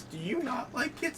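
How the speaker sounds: ordinary talk, nothing marked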